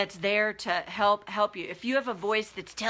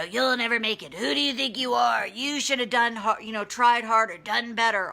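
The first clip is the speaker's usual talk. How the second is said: higher, taunting voice of the inner bully; mocking inner-critic voice, pitched up; demonic bully voice; 3 semitones higher, 4 dB louder than usual. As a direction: sneer it out like a demonic critic in her head. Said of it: "It's a fairly demonic voice".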